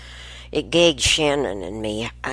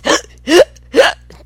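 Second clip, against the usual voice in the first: loud high honking gasp, dog's reverse sneeze; imitated reverse sneeze; 13 dB louder than usual. Grandmother imitating the beagle's inverted sneeze, loud gasp. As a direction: gasp sharply like a beagle choking. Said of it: "like he can't catch his breath".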